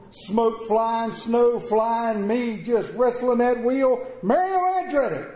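loud and wound up; wild excitement. Retold or imitated. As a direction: hype up the race. Wild drive narration.

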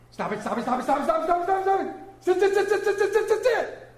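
rapid-fire commands climbing very high; hammed-up machine-gun nagging, rising in pitch; tobacco auctioneer. Parent talking to the children, rapid auctioneer patter. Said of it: "you sound like a tobacco auctioneer".

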